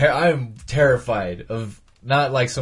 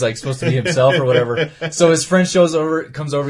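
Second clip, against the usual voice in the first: usual speaking voice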